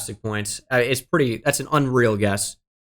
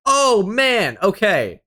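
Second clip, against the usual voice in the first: high-pitched cry of dismay; dismayed outburst; 9 semitones higher, 4 dB louder, much slower than usual. Real, not acted. Player reacting to a bad guess, dismayed outburst. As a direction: let the bad result jolt your voice up high.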